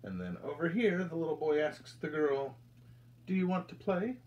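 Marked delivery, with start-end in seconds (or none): none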